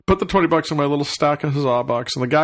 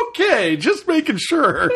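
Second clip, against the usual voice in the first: laughing; 8 semitones higher, slower than usual. Retold or imitated. Laughing reassurance to stranger.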